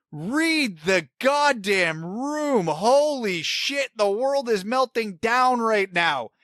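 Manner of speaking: high-pitched, drawn-out indignation; slow, emphatic fed-up rant; exasperated rant. Real, not acted. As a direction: stretch each word out in real outrage. Angry rant about the misogynistic comment.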